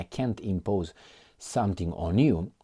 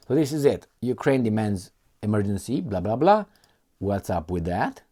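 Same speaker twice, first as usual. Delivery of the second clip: ordinary delivery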